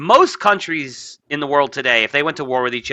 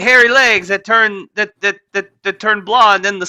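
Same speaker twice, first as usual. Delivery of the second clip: Biden impression; 6 semitones higher, 4 dB louder than usual. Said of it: "one of my favorite Biden moments".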